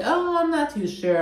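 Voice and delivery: breathy-free high imitation of an unsure answer; high mock Meredith voice. Recapping Meredith's likely answer, high and unsure. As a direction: squeak it out like she has no plan. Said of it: "it sounds like she's gonna be like".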